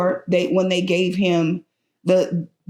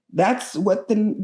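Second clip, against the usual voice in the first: ordinary talk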